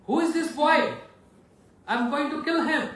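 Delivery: enraged Parshuram threatening Lakshman; furious sage. Acted. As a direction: roar at the impudent boy.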